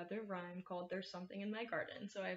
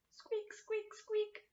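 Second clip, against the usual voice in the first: very high tiny mouse squeak; high mouse voice for the children; squeaky mouse; 12 semitones higher, much slower than usual. Acted. Mouse sound in garden rhyme.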